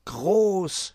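astonished kobold; amazed. A narrator's reading. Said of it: "staunte Wuschel".

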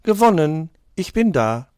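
loud triumphant call; little tortoise crying out its win at the finish stone. Acted. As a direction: shout it out, proud and loud. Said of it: "Du rufst".

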